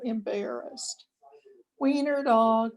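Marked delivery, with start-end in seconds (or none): 1.8-2.7 high jeering sing-song; laughing taunt. A narrator's reading. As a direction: tease him through laughter. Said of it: "laughed the other dog".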